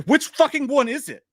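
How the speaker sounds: high, loud, flustered question; mock exasperation. Host muddling the killer's names, mock exasperation.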